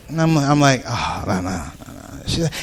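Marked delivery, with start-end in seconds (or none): 0.9-2.2 much lower, much softer murmur of no; low dismissive no. Retold or imitated. Comedian ignoring the lady, low soft brush-off. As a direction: wave her off under your breath.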